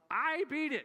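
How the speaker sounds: viel höher und lauter, Kind jubelt; mock-kid victory cry, high and loud; triumphant kid. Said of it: "he'd say".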